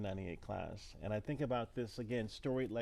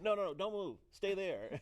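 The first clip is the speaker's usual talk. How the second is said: urgent parent voice; 8 semitones higher than usual. Retold or imitated. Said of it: "they said".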